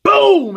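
loud, high imitated crash; shouted boom. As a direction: blurt it like an impact. Crash sound effect.

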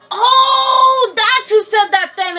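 high, loud burst of realisation; sudden realisation. Real, not acted. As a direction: blurt it as it dawns on you. Sudden realisation about the game.

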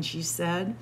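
plain reading voice

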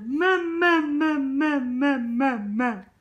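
high, loud wailing "mamá", slowed down; imitating a crying baby. Mother imitating her crying baby calling for mum, high wail. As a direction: mimic the baby's tearful cries. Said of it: "Pues llorando".

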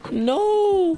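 high shocked cry; anguished no. Real, not acted. Streamer watching Piggy eat poop, shocked high cry. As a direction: wail it in disbelief.

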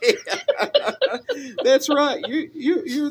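high, loud, through laughter; tickled, voice jumping up high; laughing agreement. Real, not acted. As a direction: crack up while agreeing. Caller's accent joke cracks host up.

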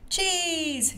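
high, drawn-out cheer; excited squeal of a storybook mouse. A narrator's reading. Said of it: "cheered Mandy".

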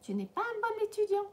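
high, fast mock reproach; mock scolding. Teacher jokingly scolds the absent student in French. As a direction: tease him like a strict teacher.

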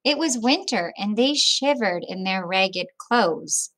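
put-on reading a picture book aloud to children; children's story read-aloud; storybook narration. A narrator's reading. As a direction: tell it gently, like story time.

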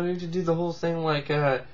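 normal talking voice

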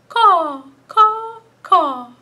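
high loud bird calls; bird caw. Retold or imitated. Librarian cawing like a bird for children. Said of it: "a cardinal is what I found".

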